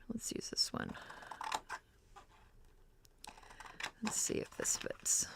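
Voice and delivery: whispered to herself; soft unvoiced muttering; whispering. Real, not acted. Muttering to herself while choosing pictures.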